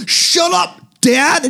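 put-on high-pitched kid yelling at Dad; petulant son. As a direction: snap at Dad like a whiny child. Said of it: "He can almost be like".

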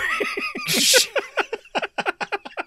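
high, loud burst of laughter; shrieking laugh. Real, not acted. Laughing hard telling friend the story. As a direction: cackle at the memory.

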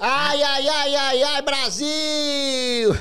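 slow, laughing drawn-out cry; Bolívia catchphrase. Retold or imitated. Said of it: "Bolívia do Desimpedidos".

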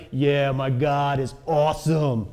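lightly put-on New York accent. Elijah after the mountaintop, swaggering New York accent. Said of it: "that's my best New York accent".

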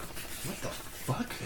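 drawn-out whispered disbelief; baffled whisper. Retold or imitated. Man retelling being beckoned by a stranger, baffled whisper. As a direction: whisper it very slowly, under the breath.

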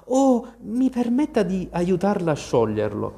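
high, eager offer of help. A narrator's reading. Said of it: "pronta a rendersi utile". (offerta premurosa)

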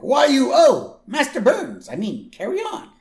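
high, loud, shaky attempt at Irish; hammed-up Irish workman; bad Irish accent. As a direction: attempt an Irish brogue and miss. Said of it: "I can't do an Irish accent".

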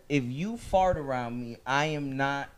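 low, quiet, lightly voiced offended woman; a hint of a prissy girlfriend; mock woman's complaint. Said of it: "a female said".

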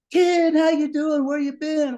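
put-on high, loud and slow, a delighted old pal; excited friend. Frank the Framer greeting Kid Curry on the phone, high delighted. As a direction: greet him like a long-lost buddy.

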